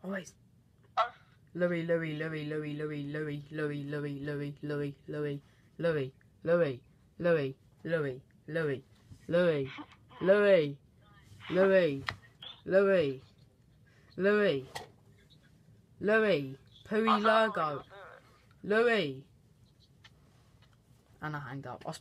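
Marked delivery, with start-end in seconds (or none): 1.6-6.1 the name rattled off fast on an even pitch; quick, monotone run of the same name; rapid-fire name repetition. Pretend. Prank caller repeats a friend's name, rapid-fire. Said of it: "I can either do it really fast". 6.4-19.2 spaced-out calls, each name falling; slow, pretending not to hear. Prank caller pretends he can't hear his friend, calling slowly. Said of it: "if I do it slow, like pretending I can't hear him".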